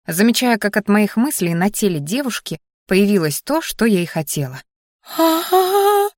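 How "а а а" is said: high, rising, drawn-out squeal; startled high-pitched cry, held long; вопль. A narrator's reading. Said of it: "заголосила Дионика". (высокий протяжный визг)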